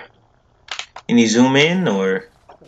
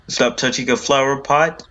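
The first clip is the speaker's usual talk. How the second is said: usual speaking voice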